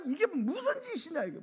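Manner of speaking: high indignant outcry; outraged disciples. Retold or imitated. Disciples outraged at the wasted perfume, high indignant outcry. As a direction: cry out in disbelief at the waste. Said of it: "제자들이 막 난리가 나가".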